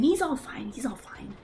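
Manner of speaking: whispered, unvoiced, fading; hushed reassurance. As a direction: whisper it to settle them.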